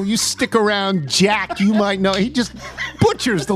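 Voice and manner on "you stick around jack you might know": lightly imitated crooner ad-lib, higher pitch. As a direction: toss off a mock lyric ad-lib, amused. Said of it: "[name], when he does this, when he says".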